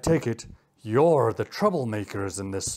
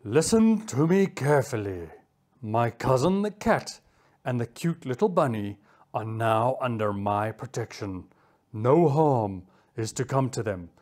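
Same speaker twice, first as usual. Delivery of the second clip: measured, commanding cheetah; stern warning; 9 semitones lower than usual. Acted. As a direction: lay down the law. Cheetah warns off foxes.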